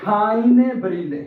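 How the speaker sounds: very slow, each word spelled out for students; slow demonstration. Shown as a demonstration. Teacher showing students the article, very slow. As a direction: drag the phrase out so learners catch it. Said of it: "ये देखिए जरा please".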